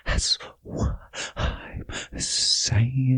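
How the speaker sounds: stretched-out whisper, each word drawn long; slow whisper. Real, not acted. Host whispering agreement again, slow and drawn out. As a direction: let the whisper drag out slowly.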